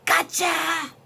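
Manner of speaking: high, loud storybook scare; ferocious yell. A narrator's reading. Rabbit jumps out at weasel, ferocious yell. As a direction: pounce with a loud, ferocious gotcha. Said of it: "yelled in his most ferocious voice".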